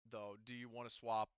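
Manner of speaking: normal talking voice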